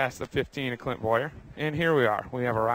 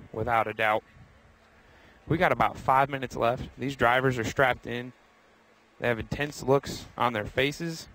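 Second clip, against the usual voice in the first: usual speaking voice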